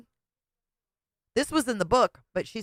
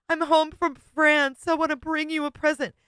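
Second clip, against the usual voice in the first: mock suitor; 9 semitones higher than usual. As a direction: parody the eager suitor. Mocking the suitor's words.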